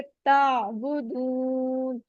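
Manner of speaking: ताबुदून drawn out slowly, the ain sound flowing on. Shown as a demonstration. Teacher models ताबुदून slowly, ain flowing smoothly. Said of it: "मखरज का हमने ध्यान रखना है".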